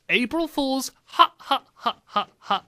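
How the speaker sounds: high mock-kiddie cheer; spaced-out, fake staccato ha-ha; forced laughs. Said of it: "their really forced laughs".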